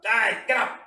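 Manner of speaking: loud, high wake-up yell; shout. Shown as a demonstration. Showing how to wake a lazy sleeper, sharp shout. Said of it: "You shout".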